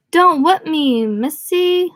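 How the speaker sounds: high, loud put-on caricature voice. Richie in a put-on voice, begging Bev not to whip him. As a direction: squeal it in a hammed-up put-on voice. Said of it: "Richie screamed in his pickaninny voice".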